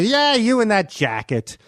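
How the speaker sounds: mock him like Norm does; Norm taunting. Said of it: "he would taunt me".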